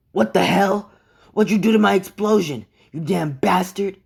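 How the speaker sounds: high, loud, outraged character yell; angry outburst. Acted. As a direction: yell it indignantly at your rival. Furious rival confronts Deku over explosion.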